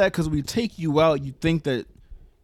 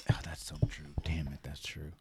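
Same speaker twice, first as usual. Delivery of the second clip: low, whispered admission to himself; hushed aside; 11 semitones lower, 16 dB quieter than usual. Real, not acted. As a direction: concede it under your breath, very softly. Hushed aside conceding the point.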